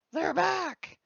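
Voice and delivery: high, loud call of relief; excited cheer. Real, not acted. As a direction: sing out the good news, high and loud. Captions return, host cheers loudly.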